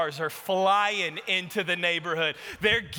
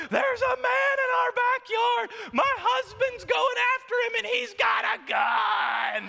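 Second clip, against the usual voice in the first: frantic phone scream. Retold or imitated. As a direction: scream it at the dispatcher in a panic. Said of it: "She's screaming on the phone".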